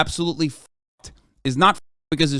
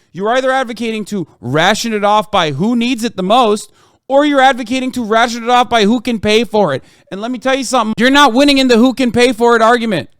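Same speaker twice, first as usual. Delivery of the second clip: rising from raised voice to a loud, high shout; building angry shout; 8 semitones higher, 6 dB louder than usual. Real, not acted. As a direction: build your fury until you're yelling at them. Heated debate, building to shouting.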